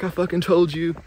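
loud, high mock angry dad; pissed off dad. Dad scolds his daughter after her car wreck, loud mock anger. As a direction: bark it like an annoyed father saying told you so. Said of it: "he's, like, pissed".

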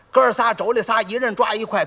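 usual speaking voice

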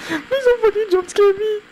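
high pleading in fright; scared horror game streamer. Real, not acted. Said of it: "don't jump scare me".

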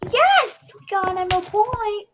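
high, loud yes; triumphant cheer. Real, not acted. Player's team gets an emerald point, triumphant cheer.

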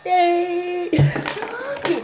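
ordinary delivery

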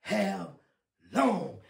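urgent pulpit warning in a high, driving cadence; fiery preaching. Real, not acted. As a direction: preach judgment hard, stretching the big words. Preaches a warning at full force.

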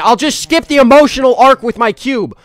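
high, loud fed-up shout; exasperated outburst. Acted. Streamer fed up with the game, skipping the emotional goodbye, exasperated yell. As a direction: throw up your hands and yell.

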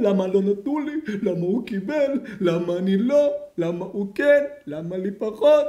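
loud mock whine, each question stretched upward; hammed-up "poor me" whining; whining complaint. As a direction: imitate an envious grumbler. Acts out an envious complainer.

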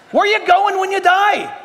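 shouted sermon question; full-force preaching. Real, not acted. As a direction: drive the question home to the crowd.

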